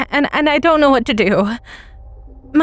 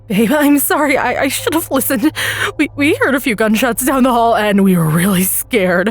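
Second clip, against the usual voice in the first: panicked sub; 3 semitones higher than usual. Acted. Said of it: "He breaks into hysterics".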